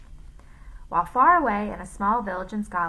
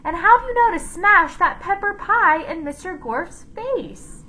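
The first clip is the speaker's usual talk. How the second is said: higher, eager question; curious child voice; 11 semitones higher, 6 dB louder than usual. A narrator's reading. A child quizzing Miss Mush, pitched high. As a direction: press her for the secret.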